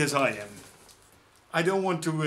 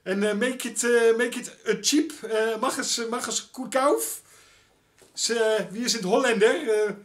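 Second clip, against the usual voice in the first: put-on high, thick Dutch accent in clumsy German; Dutch tourist German; 7 semitones higher, 4 dB louder than usual. Mocking Dutch tourists speaking German, in a hammed-up Dutch accent. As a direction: ham up a Dutch tourist ordering in German, higher. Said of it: "Otherwise you sound like some, uh, Dutch tourist".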